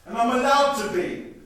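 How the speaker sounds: defiant anger. Acted. Mother defending her anger to the family, loud and defiant. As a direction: stand your ground furiously.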